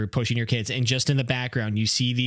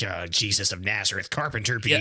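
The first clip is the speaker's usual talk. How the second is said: low, grumbling mock reverence; Pharisee bees; 8 semitones lower, 3 dB quieter than usual. Pharisee bees grumbling at the carpenter bee, low and sour. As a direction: drop the voice deep and mutter the title. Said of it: "The Pharisee bees are just like".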